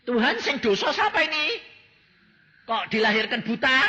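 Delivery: high, loud, urgent question from the disciples; the disciples press Jesus about the blind man; disciples asking. Retold or imitated. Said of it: "murid-muridnya berkata". (murid-murid bertanya dengan suara tinggi dan keras)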